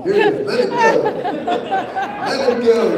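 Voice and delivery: the late mother urging her son, high and loud; mother's voice in my head. Retold or imitated. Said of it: "my mother is over here talking to me".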